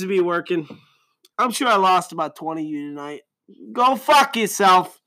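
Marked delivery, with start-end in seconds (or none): 3.7-5.0 higher, slow, drawn-out yell; mock yell. Podcaster telling lost listeners off, slow mock yell. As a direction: drag it out at them. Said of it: "I don't know why I'm talking like that".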